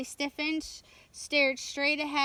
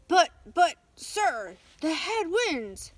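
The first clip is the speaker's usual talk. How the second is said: alarmed stammer; 5 semitones higher, 6 dB louder than usual. A narrator's reading. Mate shudders at captain's order.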